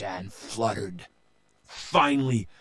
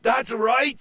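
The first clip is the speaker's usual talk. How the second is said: eager Joe; 13 semitones higher, 5 dB louder than usual. A narrator's reading. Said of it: "said Joe".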